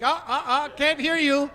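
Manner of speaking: high, loud mock deafness; pedantic dad interrupting. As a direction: cut them off in singsong refusal. Dad refuses to hear bad grammar.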